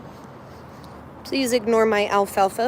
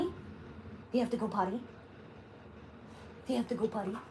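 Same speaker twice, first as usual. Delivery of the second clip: much softer coaxing question to the puppy; 3 semitones lower, 17 dB quieter, faster than usual. Real, not acted. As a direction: coo at the puppy, very soft. Asks puppy if he needs potty.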